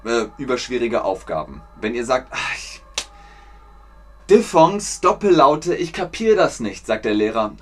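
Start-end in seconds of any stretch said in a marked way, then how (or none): 2.3-2.8 unvoiced-style breathy sigh of defeat; exasperated groan. Pupil stuck on homework sighs to teacher, exasperated. 4.3-6.8 higher, louder mock complaint; frustrated pupil. Pupil complains to teacher about diphthongs, frustrated.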